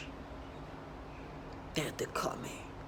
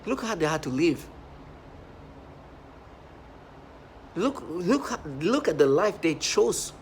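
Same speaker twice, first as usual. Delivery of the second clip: rising rant; 4 semitones higher, slower than usual. Real, not acted. Agitated rant.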